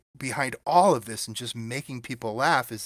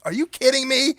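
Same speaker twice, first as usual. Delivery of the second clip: high, loud disbelieving yelp; incredulous; 13 semitones higher, 7 dB louder than usual. Retold or imitated. Puppeteer answering his teacher, loud and incredulous. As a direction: shoot it up in total disbelief. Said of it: "I'm like".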